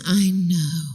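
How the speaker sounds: drawn-out, breath-emptying words; long exhaled sigh. Shown as a demonstration. Counselor showing parents the reply, sighing it out. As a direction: sigh it out instead of arguing. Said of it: "let all the air out of your lungs".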